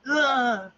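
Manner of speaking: high, loud "ah" of surprise; imitated startled cry. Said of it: "I think that's the sound, right?".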